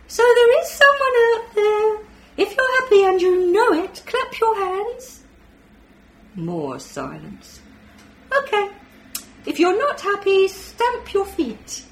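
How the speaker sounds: high, loud alien leading a game; cheery alien. Acted. Alien voice trying to win over unseen children, high and loud. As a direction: coax the unseen kids like a playful alien. Alien voice leads a clap song.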